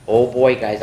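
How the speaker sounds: usual speaking voice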